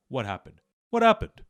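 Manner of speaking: ordinary delivery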